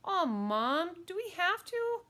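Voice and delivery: put-on high, loud kid whine; complaining child, pitch climbing; whiny protest. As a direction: whine at Mom about a chore. Boy protests babysitting chore.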